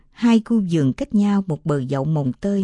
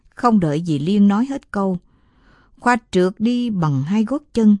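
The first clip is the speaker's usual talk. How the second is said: ordinary delivery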